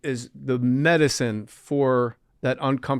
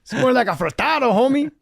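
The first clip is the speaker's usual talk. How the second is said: much higher, mock-snooty gangbanger; gangbanger quote; 8 semitones higher, 3 dB louder than usual. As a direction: play up the tough guy turned food critic.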